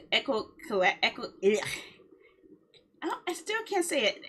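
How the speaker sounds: ordinary talk, nothing marked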